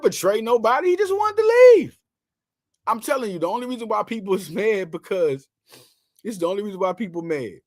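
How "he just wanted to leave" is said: high, loud shout. Real, not acted. Host telling angry Eagles fans, shouting. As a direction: yell the plain truth at them.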